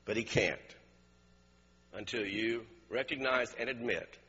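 low, measured, quiet preaching; altar appeal. Real, not acted. Preacher addressing bowed heads about needing a doctor, quiet appeal. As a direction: appeal gently, leaving long pauses.

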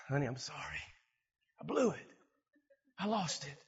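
quiet, contrite confession; soft apology. Acted. Husband apologizing to his wife after losing his temper, soft and contrite. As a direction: say it softly, in short separate admissions.

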